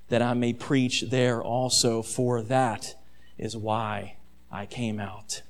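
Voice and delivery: soft, slow and low; steady quiet conviction; Jesus calmly refusing the crowd's fame; calm resolve. Acted. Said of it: "but Jesus said to them".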